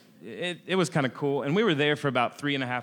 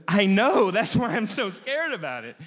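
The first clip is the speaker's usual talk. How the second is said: scared friend; 6 semitones higher, 4 dB louder than usual. Retold or imitated. Nervous friend fearing ingrown hair.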